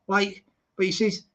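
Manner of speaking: ordinary talk, nothing marked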